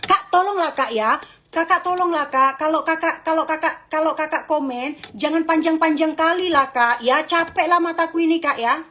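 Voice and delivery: high-pitched whiny begging; pleading. Acted. Mock pleading with commenters.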